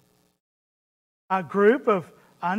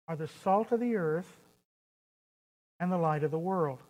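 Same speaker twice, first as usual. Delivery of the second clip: sincere. Acted. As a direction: tell them gently and sincerely that they matter. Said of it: "No, he was very sincere".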